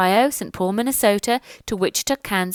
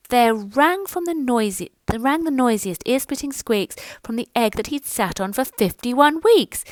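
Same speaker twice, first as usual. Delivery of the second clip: usual speaking voice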